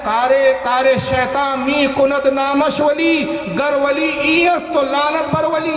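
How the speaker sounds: slow, chanted Persian couplet; recited Persian verse. Acted. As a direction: intone the verse slowly, line by line.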